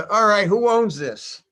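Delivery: a mock officer calling out the troops; stern captain. Captain demands the owner.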